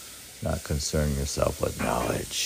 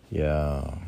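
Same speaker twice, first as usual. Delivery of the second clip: ordinary talk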